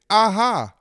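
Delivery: sudden eureka exclamation; pleased little discovery cry; bright aha. Retold or imitated. Cookie solving a puzzle, bright aha. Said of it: "a cookie who said".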